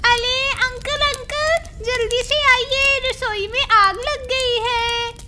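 put-on a scared girl shouting that the kitchen is burning; alarmed cry. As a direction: call uncle in panic about the fire. Girl sees kitchen fire.

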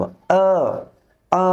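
long "আ" through pressed teeth; firm pressed vowel. Shown as a demonstration. Teacher showing learners a firm, pressed "আ". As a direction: hold "আ" firmly with teeth together. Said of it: "দাঁত আমরা চাপ দিয়ে শক্ত করে".

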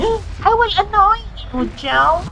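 loud teasing threat. Real, not acted. Teasing threat after a gasp.

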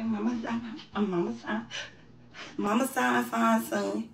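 quiet soothing mama voice; soft mother comforting son. Pretend. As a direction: hush it like a mother. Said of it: "Mama Sign, comforting son role".

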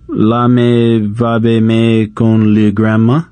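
slow, unsure French-sounding babble; uncertain fake French. A narrator's reading. Boy bluffing to teacher, slow unsure gibberish. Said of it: "he said uncertainly".

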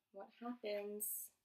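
ordinary delivery

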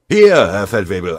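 loud shouted reply; calling back. A narrator's reading. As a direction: holler your position to the sergeant. Soldier calls back from undergrowth.

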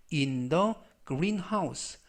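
Your belongings said very slowly; slow model reading. Shown as a demonstration. Teacher reading the phrase to students, very slow. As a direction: stretch Your belongings out for learners. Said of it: "slow model reading".

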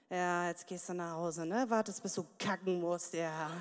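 low mocking inner voice of diners; mock sneering onlookers. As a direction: sneer at the greedy bride. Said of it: "was alle denken".

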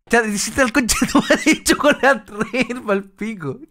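hablar riéndose, alargando las palabras; entre risas. Real, not acted. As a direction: dilo despacio, ahogado de la risa. Se ríe del dibujo recibido. (riéndose mientras habla)

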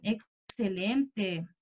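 ordinary talk

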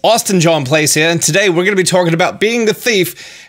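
put-on Australian accent; jokey fake Australian accent. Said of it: "You may be asking about my strange Australian accent".

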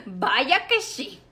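slow, sly laugh through the words; drawn-out scheming laugh. Acted. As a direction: laugh as you promise trouble. Vic laughs, plotting revenge.

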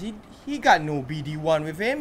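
ordinary delivery

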